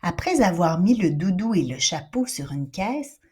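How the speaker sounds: plain reading voice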